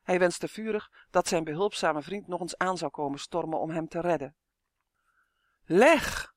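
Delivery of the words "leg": high, loud cry for help; desperate shout. A narrator's reading. Justin pinned by the dragon's gaze, shouting. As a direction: shout the name out. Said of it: "schreeuwde hij".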